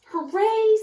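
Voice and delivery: put-on high, loud children's cheer; delighted kids shouting hooray. A narrator's reading. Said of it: "said the children".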